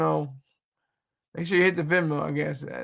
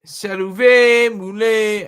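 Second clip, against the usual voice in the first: loud, higher attempt at French words; 4 semitones higher, 8 dB louder, much slower than usual. Shown as a demonstration. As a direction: toss out French words in a mock French accent. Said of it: "it's more Creole than French".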